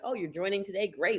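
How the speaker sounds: soft, offhand mock enthusiasm; quietly fake-cheerful welcome. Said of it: "I tell people constantly".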